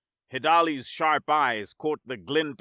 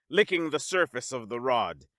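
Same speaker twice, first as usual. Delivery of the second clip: usual speaking voice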